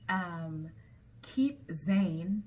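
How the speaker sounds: ordinary talk, nothing marked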